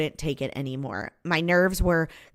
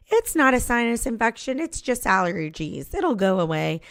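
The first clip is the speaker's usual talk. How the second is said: higher-pitched brush-off, acting out denial; mock dismissive; 4 semitones higher than usual. Patient insisting she needs no doctor, mock dismissive. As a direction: play someone waving off an illness. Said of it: "I'm totally the person that's like".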